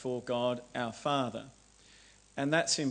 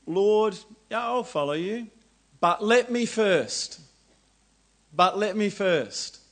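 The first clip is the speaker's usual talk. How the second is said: higher, louder, hedging excuse; reluctant excuse; 7 semitones higher, 6 dB louder than usual. Acted. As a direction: stall him with a loud reluctant excuse. Reluctant follower's excuse to Jesus.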